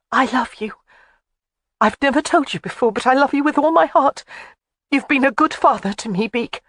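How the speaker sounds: put-on high, earnest young woman; pouring out her heart; tender confession. A narrator's reading. Jamie emotional confession.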